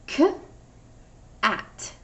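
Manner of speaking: k and at split by a pause; chunked sounds. Shown as a demonstration. Teacher demos the chunked word to first graders, sounds split. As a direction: say k, stop, then at. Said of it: "it's gonna sound like this".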